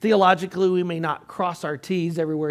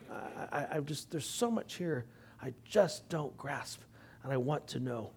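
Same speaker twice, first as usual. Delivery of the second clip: hushed humble admission; 4 semitones lower, 13 dB quieter than usual. Retold or imitated. Retelling Ryrie's humble admission.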